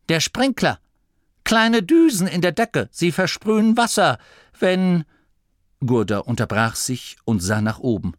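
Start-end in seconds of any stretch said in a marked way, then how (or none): 0.1-5.0 high, louder fretful abbot voice; an old gnome explaining sprinklers, rising in alarm; anxious old abbot. Acted. Abbot Gurda explains the sprinkler to Masklin, high and fretful.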